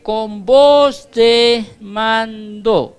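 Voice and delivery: booming, drawn-out commanding call. Acted. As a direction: ring it out like a general's order. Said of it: "el sonido del mandato del Señor". (voz de mando, lenta y atronadora)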